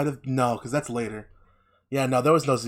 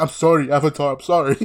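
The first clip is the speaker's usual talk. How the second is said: cowering apology; 2 semitones higher, 6 dB louder than usual. Retold or imitated. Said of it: "He's like".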